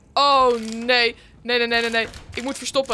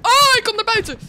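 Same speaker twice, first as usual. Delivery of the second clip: sudden high panicked yell; panicked shout; 10 semitones higher, 9 dB louder than usual. Real, not acted. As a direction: cry out in real fright as the monster comes. Scared as enemy appears.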